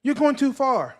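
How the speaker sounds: high-pitched imitation of a yelling mother; mama yelling. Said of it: "their mama yelling".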